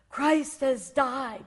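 very slow, loud, drawn-out proclamation; slow thunderous declaration; booming voice. Retold or imitated. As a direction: boom each word like thunder. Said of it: "like this loud, thunder, booming voice".

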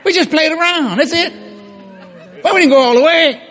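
high, loud feigned innocence; mock protesting. Mock excuses to the angry husband.

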